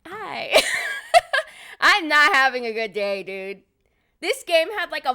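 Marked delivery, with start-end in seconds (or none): 0.0-1.8 high, loud laughing hello; laughing greeting. Real, not acted. Greeting Toddy in chat while laughing. 1.8-3.6 laughing frustration. Real, not acted. Laughing exasperation.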